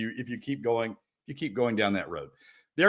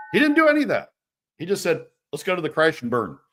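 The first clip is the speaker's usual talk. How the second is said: exasperated outburst; 7 semitones higher, 6 dB louder, faster than usual. Real, not acted. Angry rant about governor.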